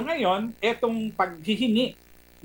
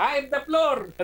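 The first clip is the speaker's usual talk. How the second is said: mock shout; 8 semitones higher than usual. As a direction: throw it out like a bossy driver yelling. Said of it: "pasigaw pa".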